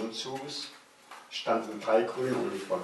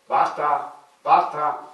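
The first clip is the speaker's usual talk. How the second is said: loud, higher station tannoy call; loudspeaker announcement; 6 semitones higher, 8 dB louder, much slower than usual. A narrator's reading. Station loudspeaker calling out to the train, booming. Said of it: "ertönte es aus dem Bahnhofslautsprecher".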